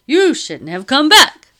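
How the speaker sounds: very loud, high, slow reproach; alarmed man's loud, drawn-out warning. A narrator's reading. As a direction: scold at high pitch and full volume. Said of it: "Lower your voice".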